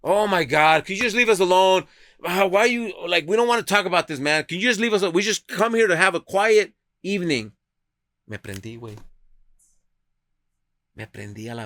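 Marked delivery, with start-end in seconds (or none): 0.0-7.5 louder and higher, fed-up complaining; annoyed diner. Retold or imitated. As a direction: mimic an irritated man snapping at a stranger. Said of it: "el señor que estaba de este lado".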